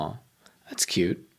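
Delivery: soft whispered aww; whispering. Real, not acted. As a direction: breathe it out softly. Aww at cute parrot news.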